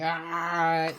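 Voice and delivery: sustained loud yell; charging scream. Acted. Player as Krill still charging, drawn-out scream. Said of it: "just charges, screaming".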